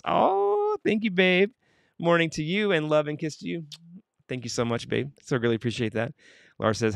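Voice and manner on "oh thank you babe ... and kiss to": touched, affectionate. Real, not acted. Host thanking babe for love and a kiss, affectionate. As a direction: coo it sweetly, pitch way up.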